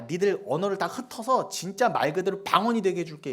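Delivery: high, loud voice of God scolding the proud; God's angry rebuke. Acted. God scolding the Babel builders, loud angry rebuke. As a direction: thunder down at the people of Babel.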